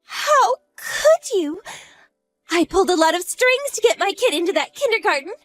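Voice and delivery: very high, tearful distressed cry; crying shame and despair; anguished wail. Acted. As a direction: break down in humiliation. Despairing, humiliated mother.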